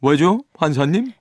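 mildly agitated defendant; anxious. A narrator's reading. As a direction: ask the judge with a touch of nerves. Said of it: "이에 초조해진 파스팔투가 물었다".

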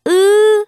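"으" held long, loud and high; Korean eu sound. Shown as a demonstration. Teacher models the vowel for students, a sustained loud high "으". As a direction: isolate the unrounded vowel "으" and hold it out. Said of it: "으 사운드에 가깝게".

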